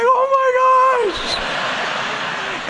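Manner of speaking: very high, stretched-out, laughing shriek; shocked girl. Retold or imitated. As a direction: drag it out in mock horror, way up high. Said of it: "this little girl in front of him is like".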